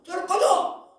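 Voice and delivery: high, loud order to get out; shouted command. Acted. Preacher casting out a demon, loud sharp command. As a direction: drive it away with one shout. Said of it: "라고 꾸짖어서 쫓아내는".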